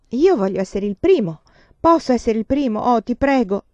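eager pleading. A narrator's reading. Child begs the adults to start the game, eagerly. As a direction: beg keenly to be picked first.

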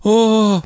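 high, pained groan; high wailing "oh"; anguished moan. A narrator's reading. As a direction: moan it in distress. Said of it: "простонала Андреа".

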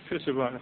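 soft unvoiced laugh under the breath; quiet amused laugh; breathy chuckle. Real, not acted. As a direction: chuckle at the irony. Laughs at divine irony.

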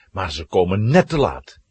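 normal talking voice

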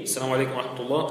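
the left-side salam, hushed and hurried. Shown as a demonstration. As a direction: toss the shorter greeting off to the left. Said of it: "في اليسار".

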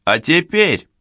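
high, loud showman announcing; booming circus ringmaster. Acted. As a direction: open the act like a ringmaster. Ringmaster opens the final act.

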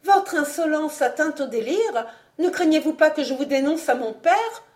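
high-pitched, outraged threat; affronted young lady, voice climbing; indignant outburst. A narrator's reading. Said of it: "s'écria mademoiselle de Vaunois".

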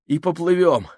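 panting as he blurts; urgent panting report. A narrator's reading. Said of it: "задыхаясь, выпалил Минхаус". (задыхаясь)

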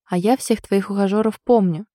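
plain reading voice

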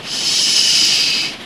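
sharp unvoiced shush. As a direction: hush him so the reading goes on. Hushing the interrupting friend.

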